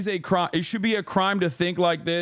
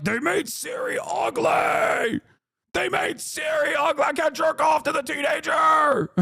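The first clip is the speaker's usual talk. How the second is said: mocking whiny fan; 7 semitones higher than usual. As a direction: shout it like a whiny fanboy throwing a tantrum. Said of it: "these guys are like".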